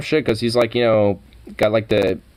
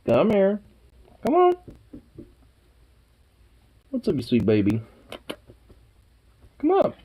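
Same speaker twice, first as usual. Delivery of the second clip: squeaky praising a dog. Real, not acted. Baby-talking to the dog Sukov.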